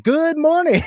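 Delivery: high, loud DJ greeting; radio DJ shout. Retold or imitated. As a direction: call it out like a morning DJ. Joking greeting for foreign listeners.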